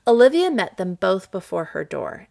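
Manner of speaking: plain reading voice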